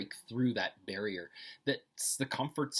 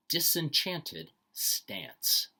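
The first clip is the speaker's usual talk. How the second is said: slow emphatic repeat; 5 semitones higher, 5 dB louder, much slower than usual. Shown as a demonstration. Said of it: "told to read each phrase twice".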